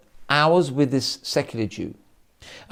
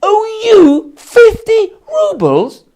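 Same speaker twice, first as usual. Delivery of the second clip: very high, loud, slow, screaming "I owe you fifty rubles?"; screaming question; 10 dB louder, much slower than usual. Acted. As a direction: scream it out in outraged disbelief. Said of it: "now he's screaming out".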